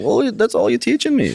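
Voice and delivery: very high-pitched indignant comeback; mock protest. Wrestler answers the friends who teach him, mock indignant. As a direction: protest in playful exasperation, voice shooting up.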